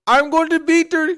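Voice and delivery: bad mock Irish accent, loud and high-pitched; botched Irish accent. Host mimicking the wrestler's promo, botched Irish accent. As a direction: belt it out in a badly faked Irish brogue. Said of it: "I can't even do that damn accent".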